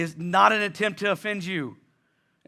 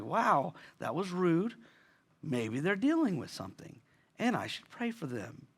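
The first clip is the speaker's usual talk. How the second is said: gentle inner thought; 2 semitones lower, 8 dB quieter than usual. Retold or imitated. Modelling the gracious inner thought.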